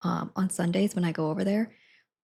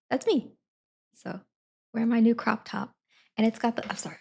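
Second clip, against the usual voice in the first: ordinary talk, nothing marked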